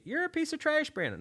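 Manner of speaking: high-pitched mock insult; mocking hater. Overseas listeners insulting the host, high mock voice. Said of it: "and they say".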